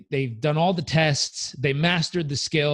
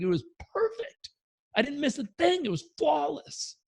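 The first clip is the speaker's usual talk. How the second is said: indignant student; 13 semitones higher than usual. Retold or imitated. Student to himself watching his test film, high, aggrieved. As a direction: insist it was flawless in a high, aggrieved voice.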